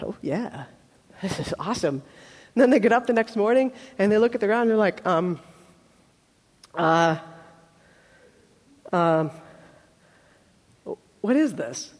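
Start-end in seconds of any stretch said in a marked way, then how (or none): 0.0-2.0 low, soft, pleased aside; low-key approval. Acted. Crowd mimicked reacting to quail. 5.0-11.9 low, halting puzzlement; long pauses between puzzled fillers; hesitate, then ask in confusion; baffled hesitation. Acted. Baffled people mimicked seeing manna.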